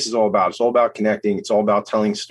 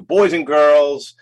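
ringmaster; 4 semitones higher, 5 dB louder, much slower than usual. Shown as a demonstration. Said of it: "that's not how I do that".

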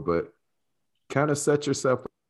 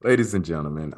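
usual speaking voice

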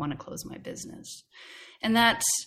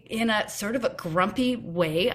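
normal talking voice

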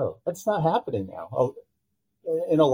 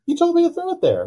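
exasperated protest; 5 dB louder than usual. Retold or imitated. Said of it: "I'm like".

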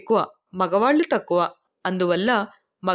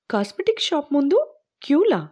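higher-pitched astonished question, rising; surprised question; 5 semitones higher than usual. A narrator's reading. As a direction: ask it in amazement, voice lifted high. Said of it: "ఆశ్చర్యంగా అడిగింది రాధ".

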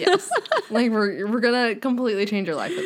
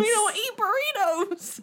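high squeal through laughter; helpless laughing; 11 semitones higher than usual. Pretend. Playing offended diner to a friend, helpless laughing. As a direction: barely get the protest out for laughing. Cracking up while voicing mock objection.

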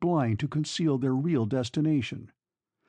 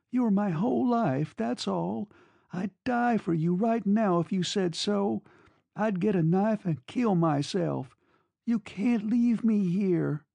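put-on high-pitched desperate girl; desperate pleading; 8 semitones higher than usual. A narrator's reading. Girl begging her lover not to leave, high and desperate. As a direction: plead high, slowing at the end. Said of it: "Her tone frightened him".

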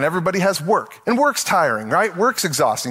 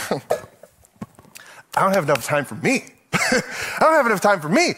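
half-laughing, drawn-out complaint; laughing whine; 3 semitones higher, slower than usual. Retold or imitated. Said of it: "And you're going".

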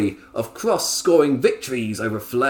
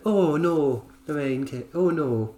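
put-on higher Scottish accent; a TV host's Scottish lilt; Lorraine Kelly impression; 4 semitones higher than usual. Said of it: "I did Lorraine Kelly earlier".

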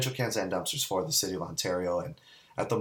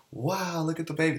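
high, wide-eyed wonder at a newborn; awed cooing; 8 semitones higher than usual. Retold or imitated. Family around a newborn's bassinet, high awed cooing. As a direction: coo over the baby with delight.

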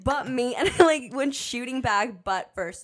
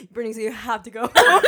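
fast, louder, laughing; rushing it out through laughter; laughing teacher quote; 7 dB louder, much faster than usual. Retold or imitated. Teacher sending her home.

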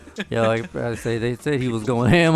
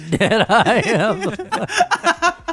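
laughing; 8 semitones higher, much slower than usual. Real, not acted. Laughing hard at a joke.

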